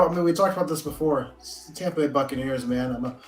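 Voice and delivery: usual speaking voice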